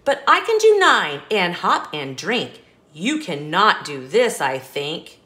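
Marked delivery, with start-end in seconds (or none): none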